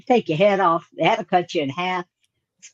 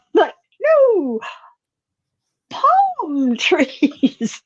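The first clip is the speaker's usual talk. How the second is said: high squeal of laughter through words; laughing; 7 semitones higher, much slower than usual. Real, not acted. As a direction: laugh it out, pitched way up. Laughing at pine tree mixup.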